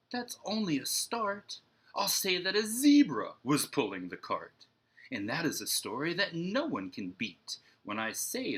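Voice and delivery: rising wonder in a picture-book read-aloud; animated reading to children. A narrator's reading. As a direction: ham up the growing daydream for kids. Marco's fantasy grows, read animatedly.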